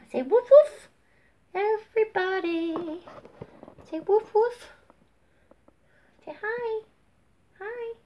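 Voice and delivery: very high, squeaky cutesy voice; tiny high voice for a pet; pet baby talk. Pretend. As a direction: prompt the dog to speak in baby talk. Prompting and voicing her pet.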